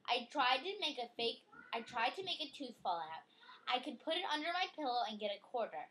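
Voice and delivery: lively storybook reading voice; reading to a child. Acted. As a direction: read it out brightly for a child. Reading picture book aloud.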